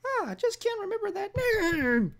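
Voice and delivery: high-pitched cartoon old lady; Mickey Mouse voice. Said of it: "why do I sound like Mickey Mouse".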